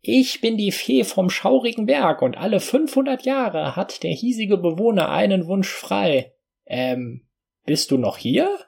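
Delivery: high, squeaky little fairy; a tiny fairy speaking. Acted. Narrator voices the fairy.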